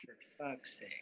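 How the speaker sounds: very soft, low swearing under the breath; low, hushed grumble at stubborn tech; exasperated mutter. Real, not acted. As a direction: mutter it to yourself in frustration. Muttering over technical trouble with unmuting.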